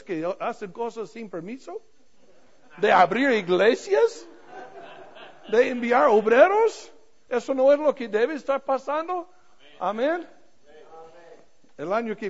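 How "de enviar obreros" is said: ordinary delivery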